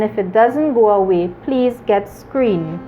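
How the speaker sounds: ordinary talk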